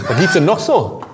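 words round a bite of food; talking with mouth full; chewing while talking. Real, not acted. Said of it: "dann solltest du mal vielleicht jetzt nicht so viel reden".

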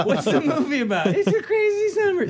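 high squeal through laughter; laughing. Real, not acted. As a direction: blurt it out mid-laugh. Laughing while telling anecdote.

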